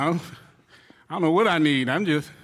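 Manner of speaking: ordinary delivery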